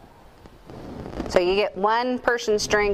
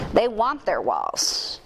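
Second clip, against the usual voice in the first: ordinary talk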